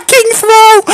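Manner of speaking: high, excited cheer; excited shout. Real, not acted. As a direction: shout it out with joy.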